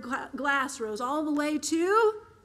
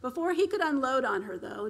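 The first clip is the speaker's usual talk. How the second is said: usual speaking voice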